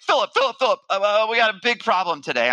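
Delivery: urgent producer. Retold or imitated. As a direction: burst in with a problem, acted out. Producer bursts in with urgent news.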